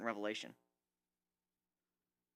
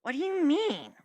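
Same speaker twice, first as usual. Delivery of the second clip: Rainbow voice; 13 semitones higher, 3 dB louder than usual. Acted. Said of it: "Rainbow asked".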